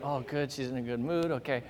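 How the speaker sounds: muttered to himself; quiet relieved thought; relieved aside. Retold or imitated. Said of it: "I'm like".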